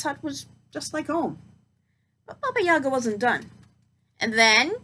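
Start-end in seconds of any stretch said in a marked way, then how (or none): 4.2-4.8 loud, drawn-out witch command; witch ordering. Acted. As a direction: hold the moment like a crone. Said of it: "she ordered".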